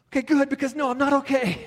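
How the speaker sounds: half-laughing confession of pain; admitting hurt. Retold or imitated. Fiancée admits she is hurt.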